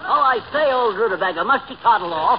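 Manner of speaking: effusive put-on British farewell; mock British accent. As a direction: fuss like a British toff. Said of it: "a fellow with a British accent".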